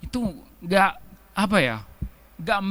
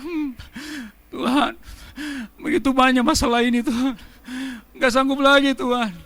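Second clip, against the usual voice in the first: softer, whimpering complaint; mild mock whine; whining to God; 4 dB quieter than usual. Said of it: "rengek-rengek". (merengek manja kepada Tuhan)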